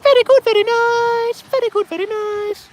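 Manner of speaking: very high squeaky praise voice, slow; falsetto very good. Pretend. Fisherman cheering his whiting bait in a silly high voice. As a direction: squeak each word like a cartoon.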